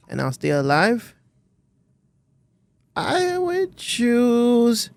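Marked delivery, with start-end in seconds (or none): 3.0-4.9 stretched very slowly and higher; long, raised stalling delivery; drawn-out answer. Real, not acted. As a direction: drag out each word, holding off the answer. Reluctant answer to forced choice.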